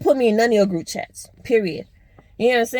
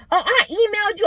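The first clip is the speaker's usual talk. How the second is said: high mock voice of the friend; 9 semitones higher than usual. Mimicking the friend's excuse about the email, pitched up. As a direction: toss it off high like her excuse. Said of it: "quoting friend".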